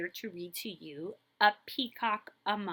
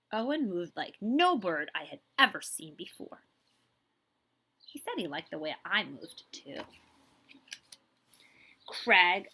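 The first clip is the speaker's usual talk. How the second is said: bright, lively storybook reading; animated read-aloud for kids; reading to children; 5 dB louder than usual. A narrator's reading. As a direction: read it like a picture book to a class.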